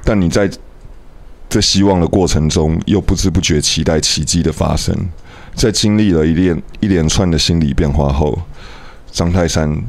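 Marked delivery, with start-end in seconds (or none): none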